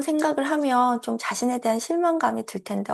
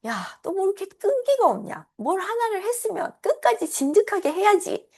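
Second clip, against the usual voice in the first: much higher scolding nag; nagging parent; 10 semitones higher than usual. Retold or imitated. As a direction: scold like a parent lecturing a child. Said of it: "뭐 이런 목소리가". (높은 목소리로 잔소리하는 부모 흉내)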